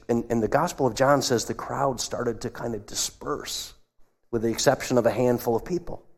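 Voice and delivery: ordinary talk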